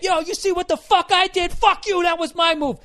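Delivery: chimp trash talk. Retold or imitated. Comic voicing chimpanzees bragging after the kill. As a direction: strut it like a chimp showing off his kill. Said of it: "Fucking talking shit".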